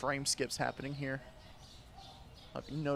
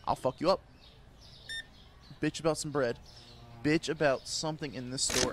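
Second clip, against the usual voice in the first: normal talking voice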